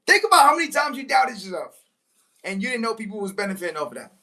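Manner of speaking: ordinary talk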